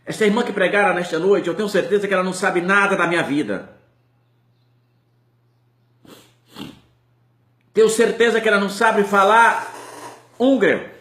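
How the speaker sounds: loud and high, crying out the judge's astonishment; impassioned testimony. Retold or imitated. Preacher voicing the judge's testimony to the church, loud and impassioned. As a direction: proclaim it loudly to the whole church.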